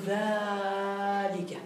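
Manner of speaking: ذلك drawn out very slowly and softly; far pointing word. Shown as a demonstration. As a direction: stretch ذلك out long and soft to show far distance. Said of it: "لكن إذا كان بعيدا فستقول".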